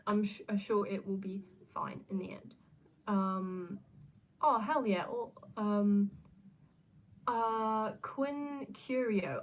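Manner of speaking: ordinary delivery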